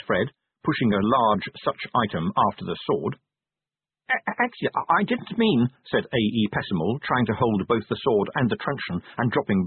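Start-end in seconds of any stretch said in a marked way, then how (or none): 4.1-5.7 high, stammering inspector voice; flustered stammer. A narrator's reading. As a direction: trip over a timid objection. Overwhelmed inspector tries to object.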